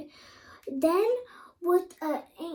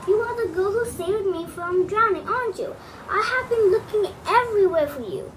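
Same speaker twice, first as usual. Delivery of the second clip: eager prince; 4 semitones higher, 5 dB louder than usual. A narrator's reading. Prince finds his lost girl.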